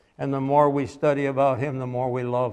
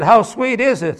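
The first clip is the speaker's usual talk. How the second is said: higher voice for the curious man; the man asking; 8 semitones higher, 7 dB louder than usual. Retold or imitated. Preacher voicing a man asking a boy. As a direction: voice the stranger questioning the boy.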